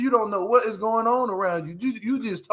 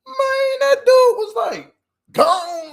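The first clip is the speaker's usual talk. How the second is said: high, amazed cry of disbelief; can't believe he just left; amazed outburst; 14 semitones higher, 6 dB louder, much slower than usual. Real, not acted. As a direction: squeal of disbelief at the screen. Shocked at the dude leaving her.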